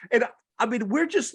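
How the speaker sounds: normal talking voice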